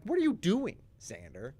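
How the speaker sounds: mock exasperated outburst, dropping on the name; acted outrage. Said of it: "everyone is furious".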